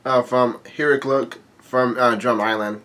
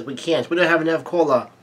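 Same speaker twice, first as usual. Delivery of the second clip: ordinary delivery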